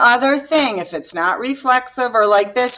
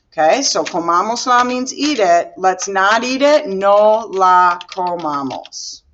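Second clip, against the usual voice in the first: ordinary delivery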